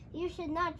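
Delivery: usual speaking voice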